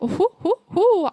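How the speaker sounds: high, bubbly mock hoot; playful hooting. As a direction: hoot it up high like a flighty free spirit. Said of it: "I am like".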